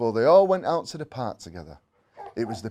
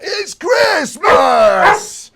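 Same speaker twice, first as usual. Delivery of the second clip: very loud, much higher, slow drawn-out yell; excited shout; 16 dB louder, much slower than usual. A narrator's reading. Jimmy wakes and yells to the house, very loud and high. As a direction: bellow it with Christmas-morning joy. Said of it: "he shouted".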